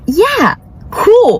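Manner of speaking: casual approval. Retold or imitated. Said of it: "I'm just like".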